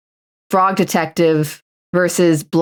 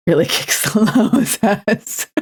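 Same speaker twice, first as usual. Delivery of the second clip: slow, higher, breaking into laughter; laughing; 4 semitones higher than usual. Real, not acted. Streamer quoting the old Winamp slogan, laughing through it.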